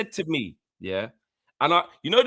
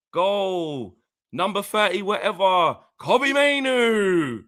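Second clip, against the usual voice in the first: mock announcer call, stretching the name; stadium goal announcer; 3 semitones higher, much slower than usual. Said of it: "you know when they do the whole".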